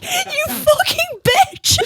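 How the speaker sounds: high, slow, laughing fake outrage; laughing mock insult. To Emma who laughed, mock outrage while laughing. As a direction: playfully curse her friend through laughter. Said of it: "I was like".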